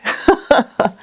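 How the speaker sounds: brief, high breathy chuckle; a quick burst of laughter; short laugh. Real, not acted. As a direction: chuckle at your own aside. Laughs at own teleprompter remark.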